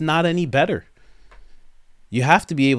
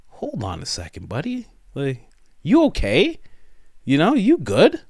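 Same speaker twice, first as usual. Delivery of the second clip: mock concern; 4 semitones higher than usual. Group questions a man talking oddly, mock-concerned. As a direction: check on a buddy with exaggerated doubt. Imagined listeners check on odd talker.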